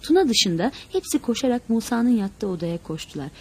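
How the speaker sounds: ordinary delivery, nothing marked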